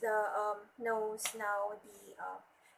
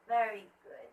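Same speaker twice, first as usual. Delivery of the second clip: ordinary talk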